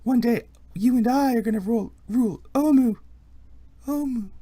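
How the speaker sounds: high, loud proclamation, softening at the end; voice from a flashback. Acted. Quaothé promising shared rule in a flashback, grandiose. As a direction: proclaim it high, with the last name echoing.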